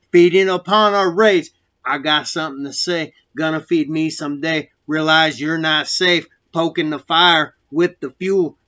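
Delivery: loud, raging spoken-word delivery; angry poem. Acted. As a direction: spit every line out with rage. Recites his prison poem.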